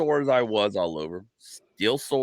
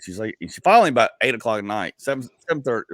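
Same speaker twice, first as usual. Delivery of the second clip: ordinary talk, nothing marked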